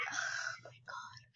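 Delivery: whispered aside. Acted. Maid dreading the summons, whisper. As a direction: breathe it out in dismay.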